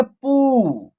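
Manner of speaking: पू with the ऊ held long; long vowel. Shown as a demonstration. Teacher showing प with the matra, पू drawn out. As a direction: stretch the vowel in पू. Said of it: "उच्चारण long तरीके से करेंगे".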